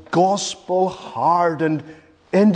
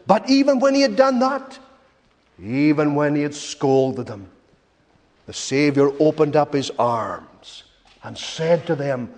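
ordinary delivery

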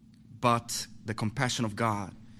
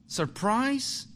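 surprised; 10 semitones higher than usual. Acted. Rhetorical surprise reveal.

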